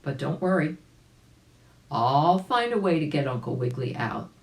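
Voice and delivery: usual speaking voice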